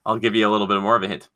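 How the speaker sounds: ordinary talk, nothing marked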